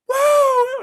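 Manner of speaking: high, loud whoop; imitating a drunken victory whoop; drunk celebration whoop. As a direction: whoop in the street after the win. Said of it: "I was going".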